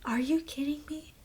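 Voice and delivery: breathy hushed disbelief; mock shocked whisper. Real, not acted. Vlogger reacting to the movie twist, shocked whisper. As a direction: whisper it like you can't believe the twist. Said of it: "Did you see that coming? I sure didn't".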